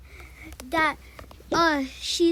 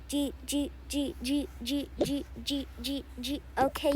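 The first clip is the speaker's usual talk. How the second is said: usual speaking voice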